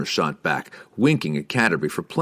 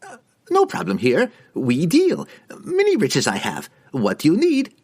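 high, eager merchant voice; fawning, nervous trader; 9 semitones higher than usual. A narrator's reading.

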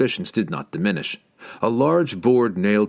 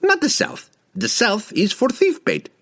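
higher, lively market trader; merchant voice. A narrator's reading. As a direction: hawk it like a desert merchant. Merchant's voice in the story.

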